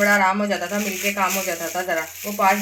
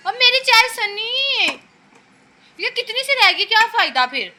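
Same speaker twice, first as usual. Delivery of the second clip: much higher, louder playful whining; whiny complaint; 12 semitones higher, 4 dB louder than usual. Real, not acted. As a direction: whine about the tiny cup of tea. Complaining about her small tea. (Sunny से थोड़ी चाय पर ऊँची, ज़ोरदार, चंचल शिकायत)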